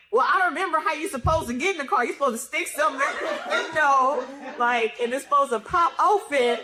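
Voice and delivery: high, fast, laughing; frantic scheming. Retold or imitated. Trying to break into the car, fast and high. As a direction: rattle it off in a rush. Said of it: "I'm like".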